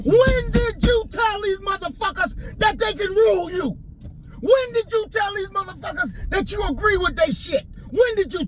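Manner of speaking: indignant preacher's cry; high challenging shout. Real, not acted. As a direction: throw the question at them high. Rhetorical challenge to listeners.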